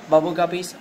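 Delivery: ordinary talk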